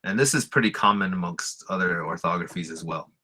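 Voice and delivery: usual speaking voice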